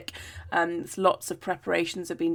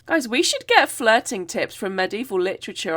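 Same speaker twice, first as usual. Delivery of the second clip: ordinary talk, nothing marked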